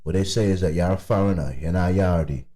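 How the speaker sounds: slipping into Jamaican patois; Jamaican accent. Shown as a demonstration. Explaining to a friend what a yardie is, Jamaican accent. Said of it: "when a Jamaican say you're a yardie".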